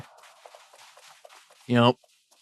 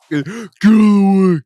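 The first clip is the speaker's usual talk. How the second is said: yelling; 5 semitones higher, 11 dB louder, much slower than usual. Real, not acted. Yelled slowly.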